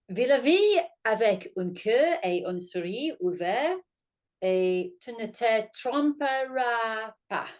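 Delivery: slow, drawn-out French recital in an amateur accent. Shown as a demonstration. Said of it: "picked up a lovely phrase in French".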